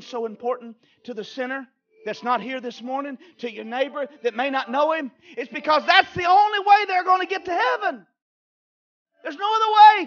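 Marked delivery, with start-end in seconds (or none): 5.4-10.1 full-force preacher shout; preaching shout. Real, not acted. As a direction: drive the point home hard. Preacher climaxing.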